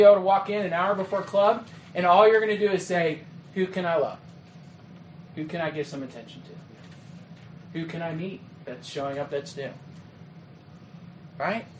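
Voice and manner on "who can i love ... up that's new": soft, lower and unhurried; calm, caring. Acted. Well-planned leader looking for kids to love, calm and caring. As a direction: ease right down into gentle, open attention.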